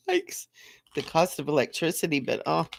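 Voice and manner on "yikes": high squeaky mock yikes; a quick high-pitched wince; mock alarm at the bill. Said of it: "I'm kinda like".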